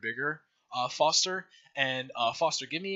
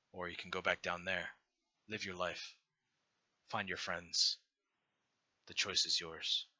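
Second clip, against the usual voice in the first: low, soft, unhurried divine voice; a gentle deity offering a choice, calm and low; benevolent god; 4 semitones lower, 4 dB quieter than usual. Acted. God offering the dying hero a choice, low and soft.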